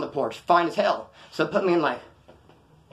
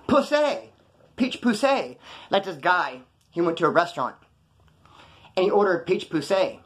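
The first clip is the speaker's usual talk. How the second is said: usual speaking voice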